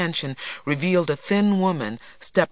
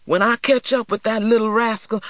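put-on high, blustering boy's threat; indignant threat; 7 semitones higher, 4 dB louder than usual. A narrator's reading. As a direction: bluster about payback, pitched well up. Acted boy threatening to beat someone.

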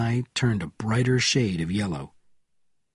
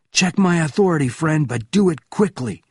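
high, raised, commanding reply; forceful order; 6 semitones higher, 4 dB louder than usual. A narrator's reading. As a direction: order him urgently in a raised voice. Benjamin barks back at shouting officer.

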